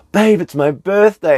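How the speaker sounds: high, loud, hammed-up excitement; birthday excitement. Partner waking him on birthday morning, squealed mock excitement.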